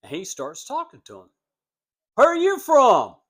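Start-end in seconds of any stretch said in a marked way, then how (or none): 2.2-3.2 much higher and much louder, a southern local sizing up a stranger; suspicious local. Retold or imitated. Southern businessman questioning the Yankee salesman, loud and high. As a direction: ask it high and loud, already doubting him.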